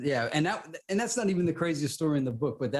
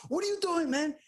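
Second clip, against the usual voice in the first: high panicked cry, voice leaping up; terrified passenger pleading over a crashing plane; panicked question; 14 semitones higher than usual. Retold or imitated. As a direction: shriek it like the plane is falling. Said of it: "And the guy goes".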